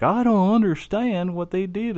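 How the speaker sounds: high-pitched mock Southern accent. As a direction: mimic clueless people in a Southern accent. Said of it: "had to affect a Southern accent".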